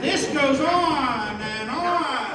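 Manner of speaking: slow, stretched-out storytelling; drawn-out narration. A narrator's reading. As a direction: hold each "on" long, as if endless. Narrator stretches the endless action.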